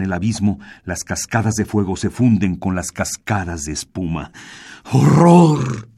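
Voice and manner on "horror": higher, louder cry of horror; horrified cry. Acted. Martínez screaming at the fire and the torrent, loud. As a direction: scream at what he sees. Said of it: "gritó Martínez".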